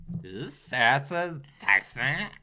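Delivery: slow put-on voice; drawled mock line. Comedian playing the girl in a sketch, slow put-on voice. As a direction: drawl it out like a silly date. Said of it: "I'll be like".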